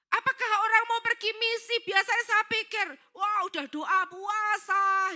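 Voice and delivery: rally them at full force; fired-up exhortation. Acted. Preacher imagines a zealous mission send-off, fired-up.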